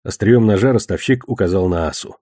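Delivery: ordinary delivery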